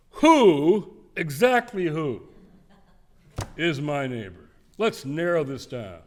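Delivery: loud, drawn-out lawyerly cross-examination; pointed Washington lawyer. Retold or imitated. Preacher mimicking a lawyer who wants to narrow it down, pointed and drawn out. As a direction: pin him down like a Washington lawyer. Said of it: "I know this tone of voice".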